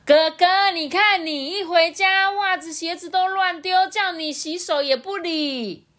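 high-pitched mom yelling from the kitchen. A narrator's reading. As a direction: scold your son across the house, very high. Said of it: "象妈在厨房大喊说". (妈妈在厨房高声喊骂)

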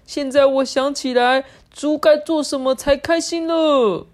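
high, gleeful pig shriek; acted delight, high and drawn out; happy squeal. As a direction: squeal with joy, stretching out the words. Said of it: "快乐的尖叫说".

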